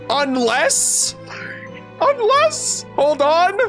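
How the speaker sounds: high, questioning rise on each word; halting, high-pitched second thought; sudden realization. Real, not acted. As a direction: stop short as it dawns on you. Stops short on a sudden realization.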